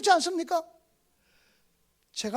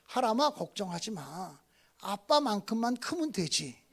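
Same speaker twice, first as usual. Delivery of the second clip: reassuring father; 9 semitones lower, 8 dB quieter, slower than usual. Retold or imitated. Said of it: "그래서 이렇게 얘기를 했어요".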